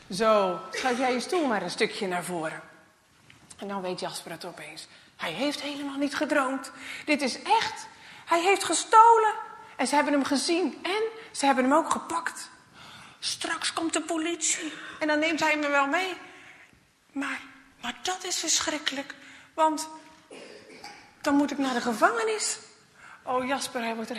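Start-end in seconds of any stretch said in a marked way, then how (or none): 0.1-2.6 stern man's voice. Acted. The man speaks. 5.2-21.5 higher, louder panicked realisation; rising panic. Acted.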